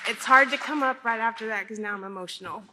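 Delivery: ordinary talk, nothing marked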